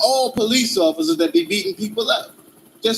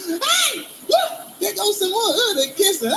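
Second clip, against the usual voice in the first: laughing while pointing; 7 semitones higher than usual. Retold or imitated. Mock neighbor pointing and laughing.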